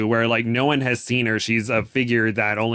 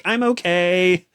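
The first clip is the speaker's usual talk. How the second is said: put-on higher, drawn-out quote of the girl; mock reassurance; 6 semitones higher, slower than usual. Retelling a bloodied book character to co-host, mock reassurance. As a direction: slowly imitate someone insisting all is fine.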